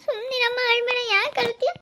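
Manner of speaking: high-pitched plea to stop crying; anxious, high-voiced hushing of someone weeping; pleading comfort. Child pleads with crying parent.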